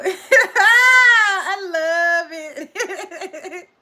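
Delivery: high, loud, drawn-out laughing squeal; laughing delight. Real, not acted. Streamer delighted at the name in the chat, loud laughing delight. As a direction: shout it slowly through laughter.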